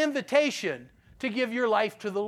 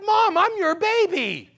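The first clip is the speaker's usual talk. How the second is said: higher, laughing, whining to mom; laughing plea; 6 semitones higher, 3 dB louder than usual. Retold or imitated. Son to his mother, a laughing higher plea. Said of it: "I'm like".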